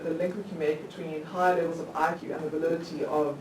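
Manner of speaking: ordinary talk, nothing marked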